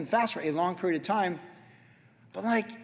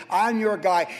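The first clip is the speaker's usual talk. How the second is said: normal talking voice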